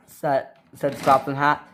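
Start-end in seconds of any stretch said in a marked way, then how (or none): none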